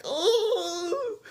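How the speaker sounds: high, drawn-out mock whimper; freaked-out wail. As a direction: imitate a long, scared high moan. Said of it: "he's all freaked out".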